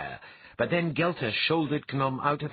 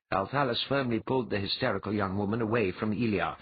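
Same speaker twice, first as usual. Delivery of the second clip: ordinary delivery, nothing marked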